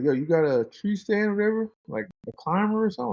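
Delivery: a hint of the friend asking; buddy's voice. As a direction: lift the pitch for the friend's casual question. Buddy on the phone in story.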